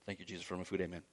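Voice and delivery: very soft, low mumbled mock grace; bashful prayer. Bashful diner saying grace out at a restaurant, mock hushed mumble. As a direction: mumble it like you hope nobody hears. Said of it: "Just because we're bashful".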